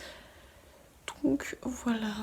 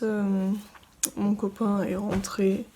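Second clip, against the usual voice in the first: usual speaking voice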